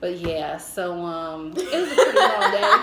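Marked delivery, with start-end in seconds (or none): 1.6-2.8 a laugh in the voice, louder; chuckling wrap-up. Real, not acted. Laughing as she finishes the bathroom story for her friend.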